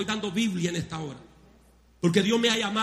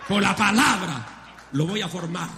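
preaching shout; 5 dB louder than usual. Real, not acted. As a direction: thunder it at the congregation. Pastor preaching at full force.

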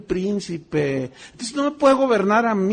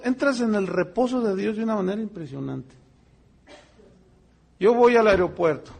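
normal talking voice

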